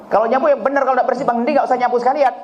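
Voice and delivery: high, sharp scolding, fast at the end; harsh scolding mother. Retold or imitated. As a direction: scold the child for sweeping badly. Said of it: "Ini ada model ibu begini". (ibu yang memarahi anak dengan suara tinggi dan cepat)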